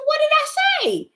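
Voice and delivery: very high-pitched, retold puzzled question; mock bewilderment. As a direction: squeak it out in mock protest. Retells asking her laughing son.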